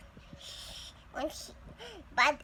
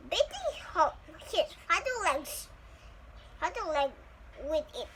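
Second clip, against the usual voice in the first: ordinary delivery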